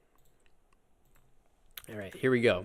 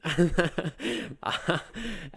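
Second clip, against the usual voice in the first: a short amused laugh at his own joke; 6 semitones higher than usual. Real, not acted. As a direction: laugh it off lightly.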